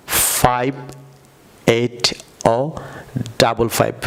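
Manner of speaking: slow British drill, ও for zero, ডাবল ফাইভ; British number style. Shown as a demonstration. Teacher showing viewers the British way: ও and ডাবল ফাইভ. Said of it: "আমার সাথে বলুন".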